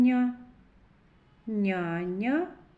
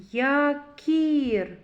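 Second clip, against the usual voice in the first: Якір slowed, the middle К picked out higher and firmer; stress on К; 4 semitones higher, 4 dB louder than usual. Shown as a demonstration. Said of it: "м'який приголосний".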